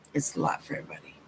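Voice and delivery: breathy whispered repeat, fast; whispered aside to the viewer. Shown as a demonstration. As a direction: drop it to a hushed whisper. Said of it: "repeated after plain take".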